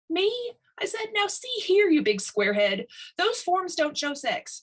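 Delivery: high, loud indignant retort; riled retort. A narrator's reading. Dad snaps back at Tiny, high and riled. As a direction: bristle back at the big squarehead.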